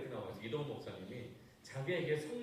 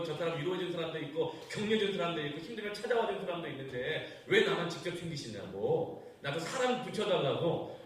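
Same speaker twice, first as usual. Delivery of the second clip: angry complaint; 5 semitones higher, 7 dB louder than usual. Retold or imitated. 외로운 신자가 하나님께 따지며 화난 큰 소리로 항의. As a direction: pour out your grievance with rising anger. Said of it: "막 더 화가 난 거예요".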